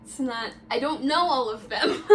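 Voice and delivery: loud laugh breaking into the words. Real, not acted. Girlfriend explaining about his many friends, laughing. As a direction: protest through your laughter. Laughing while defending herself.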